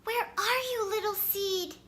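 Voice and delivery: high calling search; little fox calling for his missing friend. A narrator's reading. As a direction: call out high to find him.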